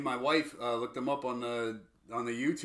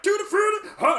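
much higher, louder wild yelp; 8 dB louder than usual. Retold or imitated. Imitating [name] bursting on stage, high and loud. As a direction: shriek it like a wild rocker.

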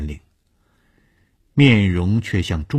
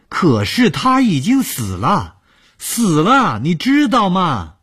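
high, loud horrified cry; 14 semitones higher, 6 dB louder, slower than usual. Acted. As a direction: cry it out in horror. Desperate horrified outburst.

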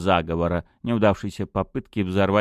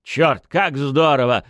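excited; 7 semitones higher, 6 dB louder than usual. A narrator's reading. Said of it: "сказал Байлз".

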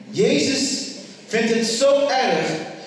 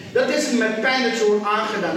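ordinary talk, nothing marked

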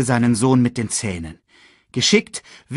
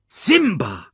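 raised, sharp calling of the name; harsh rebuke; 8 semitones higher, 3 dB louder than usual. A narrator's reading. Scar confronts the grieving Simba, harshly. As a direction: snap at the child accusingly. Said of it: "herrschte Scar ihn an".

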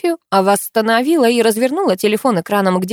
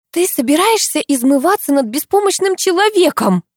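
much higher, stern reproach; 10 semitones higher, 4 dB louder than usual. Acted. Alisa sternly scolds narrator for mocking helpless man. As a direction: scold her like a strict teacher. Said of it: "строго сказала Алиса".

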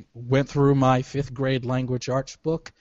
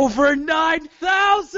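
high, loud anime-style yell; meme shout; 4 dB louder than usual. Pretend. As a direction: belt it out like a battle cry.

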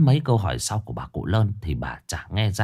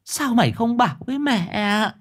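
1 anguished reproach. A narrator's reading. Mother reproaches son.

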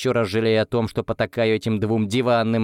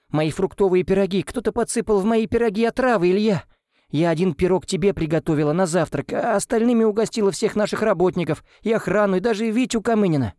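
high-pitched frantic rush; panicked; 9 semitones higher than usual. A narrator's reading. Nanny tells Ilya the pies were poisoned, panicked and high. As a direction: blurt it all out in alarm.

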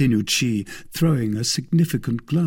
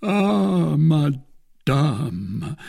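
slightly raised, theatrical lament; melancholy; 6 semitones higher, 3 dB louder, much slower than usual. A narrator's reading. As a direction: put on mournful melancholy. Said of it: "in the most melancholy tone".

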